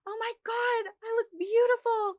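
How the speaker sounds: high, gushing client admiring her new brows; mock squeal of a happy customer; delighted client. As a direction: pitch it way up like a thrilled girl at the mirror. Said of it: "the girl looks in the mirror and says".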